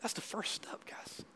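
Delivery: hushed aside to the congregation; whisper. Real, not acted. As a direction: lean in and whisper it. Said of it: "unvoiced whisper".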